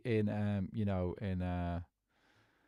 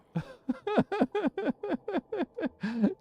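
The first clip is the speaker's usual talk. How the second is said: long, high-pitched cackling laugh; helpless high giggling in a long run. Real, not acted. Player after almost falling off, cracking up.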